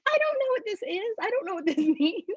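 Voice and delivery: high, fast, laughing confusion; high giddy bewildered rush; flustered disbelief. Retold or imitated. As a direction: squeal it out, half laughing and overwhelmed. Stunned reaction to revelation.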